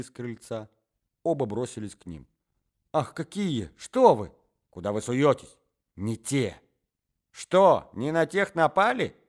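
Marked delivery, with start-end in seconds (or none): none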